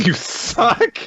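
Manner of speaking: very high, loud squeaky question; high-pitched mock disbelief; mocking echo. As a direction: squeal the insult back in falsetto. Echoing the quoted insult.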